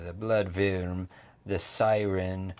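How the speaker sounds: ordinary delivery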